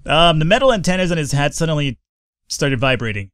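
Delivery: normal talking voice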